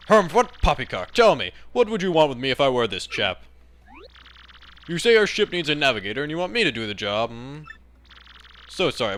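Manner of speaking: stuffy English seadog, indignant; hammed-up old admiral turning them down; huffy old British sailor. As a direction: refuse with pompous British bluster. Old sailor refuses the job.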